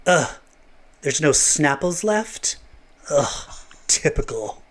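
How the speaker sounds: slow, disgusted character grumble; sulky put-on guy, dragging the words; Quentin Quire. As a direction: sigh it out, put-upon and drawn out. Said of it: "Quentin Quire".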